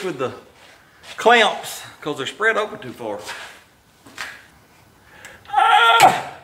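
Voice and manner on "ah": high loud strained ah; sudden loud straining shout; effortful cry. Real, not acted. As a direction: grunt out a sharp cry of effort. Straining on the clamps.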